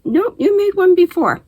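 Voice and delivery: put-on high, insistent child voice; daughter's voice. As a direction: insist like a stubborn kid. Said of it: "And she".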